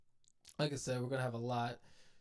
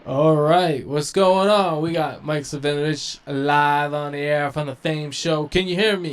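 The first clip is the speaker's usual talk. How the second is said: loud calling out; 3 semitones higher, 14 dB louder than usual. Real, not acted. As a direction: project it like calling down a bad line. Host calling out on live call.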